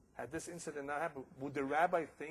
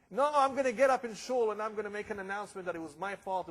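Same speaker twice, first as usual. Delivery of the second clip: rabbi's loud vow; 6 semitones higher, 8 dB louder than usual. Acted. Rabbi vows public apology.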